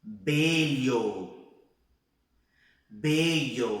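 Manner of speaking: BELLO loud and drawn out, closed e and o; closed vowel. Shown as a demonstration. Teacher giving a word to repeat, closed vowel. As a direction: model BELLO with a narrow mouth. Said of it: "Vamos repetir comigo?".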